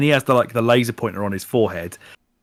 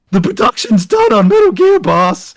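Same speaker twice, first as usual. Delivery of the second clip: very high, eager little worker; high squeaky worker voice; 10 semitones higher, 5 dB louder than usual. Pretend. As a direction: squeak it out like a nervous underling. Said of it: "Billy's like".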